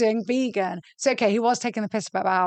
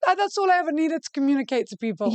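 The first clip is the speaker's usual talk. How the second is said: imitating mum; 7 semitones higher than usual. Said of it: "She's like".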